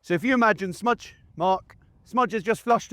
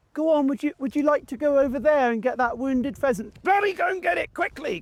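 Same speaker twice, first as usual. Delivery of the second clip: much higher, frantic pleading; mock a flustered owner begging the dog; overpleading handler; 9 semitones higher than usual. Handler mocking how he would not ask his dog, high and frantic. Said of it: "kind of thing".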